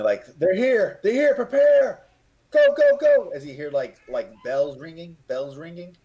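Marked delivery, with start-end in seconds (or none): 0.4-3.3 much higher, very loud alarm yell; lieutenant yelling orders to the soldiers. Acted. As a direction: scream the warning down the wall as the horde comes. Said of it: "the lieutenants and so forth yelling".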